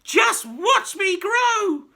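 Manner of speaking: put-on high, loud, slow screechy old woman; wild old grandma yelling as she rises; shouting old crone. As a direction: stretch it out in a gleeful screech. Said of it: "she shouted".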